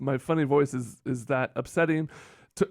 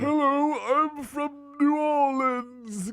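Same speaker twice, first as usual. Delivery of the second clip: put-on very high, slow New Orleans caricature accent; mock American accent; 13 semitones higher, much slower than usual. Student shows professor his hammed-up New Orleans accent. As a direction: pitch way up, drawl a silly Southern American accent. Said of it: "Give me your best American accent".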